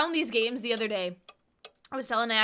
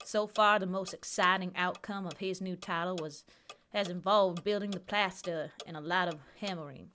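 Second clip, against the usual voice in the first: softer, steady reading voice; reading aloud; 3 semitones lower, 4 dB quieter than usual. Acted. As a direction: read the manuscript evenly. Reads game manuscript aloud.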